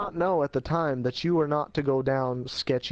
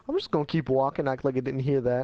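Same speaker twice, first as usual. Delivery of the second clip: ordinary delivery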